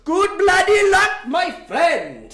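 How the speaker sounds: slow, booming mock Indian accent wishing luck; a big jokey Indian accent for the winner. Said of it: "I went to an Indian accent".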